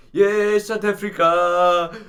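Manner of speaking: slow, stretched, high triumphant yell. Retold or imitated. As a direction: belt it out like a gloating fan. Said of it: "marches around school on the way to his class yelling".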